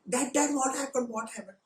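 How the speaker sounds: high, loud and eager, fading; a child pestering for the story. Retold or imitated. Son begging father for news, eager and excited.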